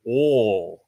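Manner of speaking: "oh" drawn out like awl; oil as one vowel, "oh"; mushed Southern oil. Retold or imitated. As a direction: say "oh" for oil, no glide. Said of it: "So, so I hear the".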